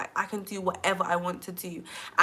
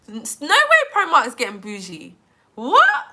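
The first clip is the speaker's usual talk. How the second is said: high, loud disbelief rising to a squeaked what; incredulous; 5 semitones higher, 9 dB louder than usual. Real, not acted. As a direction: react in shock, jumping up in pitch. Reacts to news about Primark.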